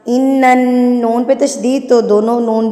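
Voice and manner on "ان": slow, drawn-out melodic tilawat; Quran recitation. Shown as a demonstration. Teacher recites the verse in tilawat style. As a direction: recite with long stretched vowels.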